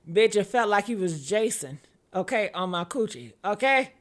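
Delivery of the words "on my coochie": usual speaking voice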